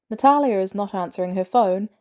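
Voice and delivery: usual speaking voice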